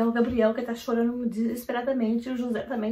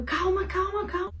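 frantic calming; 9 semitones higher than usual. Retold or imitated. Mother soothing panicked family.

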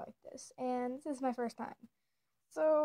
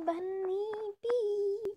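character intro; 7 semitones higher, much slower than usual. Acted. Self-introduction with name.

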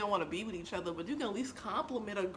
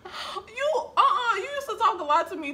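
laughing protest; 11 semitones higher, 6 dB louder than usual. Real, not acted.

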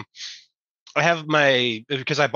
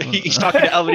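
usual speaking voice

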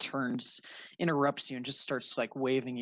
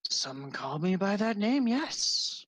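put-on elderly wizard; 7 semitones higher, slower than usual. Wizard answers to his name.

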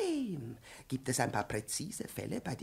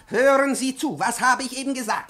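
angry demand; 8 semitones higher, 9 dB louder than usual. Acted. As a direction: bark the question at her. Professor demands attention angrily.

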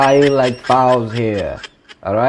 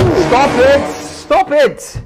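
sharply raised, exasperated voice; exasperated shout; 10 semitones higher, much slower than usual. Acted. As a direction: shout over them to make them stop. Interviewer cuts off the bad pitch.

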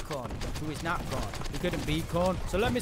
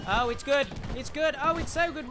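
high, loud and thrilled; squealing with glee; excited delight; 9 semitones higher, 6 dB louder than usual. Real, not acted. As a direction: gush over the plant. Delighted reaction in game.

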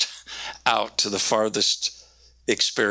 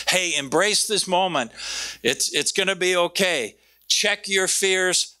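higher, gently urging, slow on check your fears; reassuring Jesus; 9 semitones higher than usual. Retold or imitated. Jesus calming scared disciples in the boat, reassuring. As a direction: coax them out of fear.

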